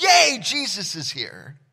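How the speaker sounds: hammed-up excited crowd; mock cheer. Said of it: "they're like".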